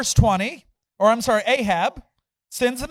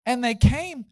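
ordinary talk

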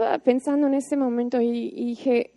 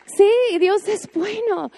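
higher, bright exclaim of praise; a happy, higher cry of faith; imagining a believer's joyful yes, higher and glad; joyful affirmation; 7 semitones higher, slower than usual. Retold or imitated. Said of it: "esa visualización que dices".

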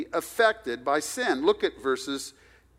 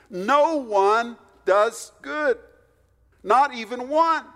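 "No one" much higher, louder and slow; emphatic preaching; 9 semitones higher, 4 dB louder, slower than usual. Shown as a demonstration. Preacher pressing the verse on hearers, high loud emphasis. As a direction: drive home "No" with rising force. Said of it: "Notice how unequivocal".

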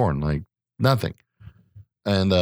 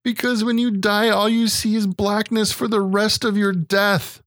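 put-on high, plaintive child voice; mock little-boy lament; seven-year-old son; 11 semitones higher, slower than usual. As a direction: imitate an upset little kid explaining, slowing down. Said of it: "He's like".

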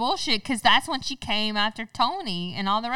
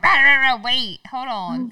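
mock gibberish; 3 semitones higher, 7 dB louder than usual. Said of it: "he was like".

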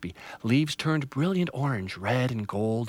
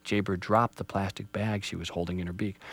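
plain reading voice

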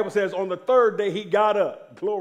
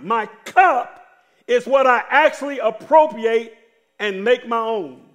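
loud, raised-pitch pulpit delivery; forceful preaching; 4 semitones higher, 7 dB louder than usual. Real, not acted. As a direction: drive each point home to the congregation.

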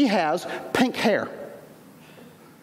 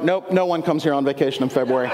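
lower-pitched territorial local, lightly imitated; standoffish islander; 6 semitones lower than usual. Islanders to a vacationing visitor, lightly mimicked standoffish. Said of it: "they were very territorial and standoffish".

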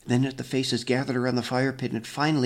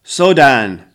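stern summons; 2 semitones higher, 14 dB louder than usual. A narrator's reading. Grandfather summons the accused.

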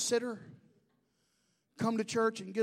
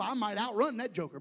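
ordinary delivery

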